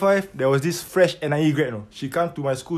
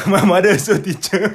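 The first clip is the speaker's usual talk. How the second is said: laughing; 3 semitones higher, 5 dB louder than usual. Real, not acted. Echoing friend while laughing.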